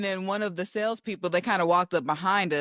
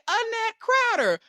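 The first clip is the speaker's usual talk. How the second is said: high, slow full-name call; government name call; 12 semitones higher, slower than usual. Retold or imitated. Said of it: "Then I finally say".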